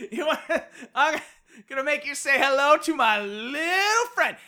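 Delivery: put-on drunk character slurring a movie line, pitch swooping up; hammed-up drunken boast; drunken stupor. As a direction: imitate a drunk making a playful threat. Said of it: "in this drunken stupor".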